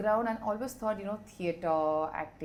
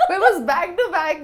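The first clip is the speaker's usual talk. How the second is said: loud laugh spilling into the words; real laughter; 10 semitones higher, 13 dB louder than usual. Real, not acted. Mother laughing about two unplanned kids, loud laughter.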